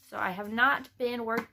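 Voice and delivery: ordinary talk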